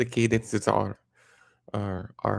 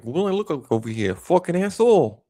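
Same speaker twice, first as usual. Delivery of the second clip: higher-pitched, louder Jersey wise guy; Jersey accent; 7 semitones higher, 5 dB louder than usual. Acted. Showing off a fun Jersey accent, pushy. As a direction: play a pushy Jersey loudmouth. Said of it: "I think the Jersey accents are fun".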